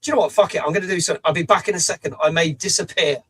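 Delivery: normal talking voice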